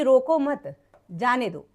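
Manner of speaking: slow रोको मत, a pause, then जाने दो; group "रोको मत" as one, break, then "जाने दो"; pause after मत. Shown as a demonstration. Teacher explains the third sentence, pausing after मत. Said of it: "तीसरे वाक्य में आपसे कहा जा रहा है".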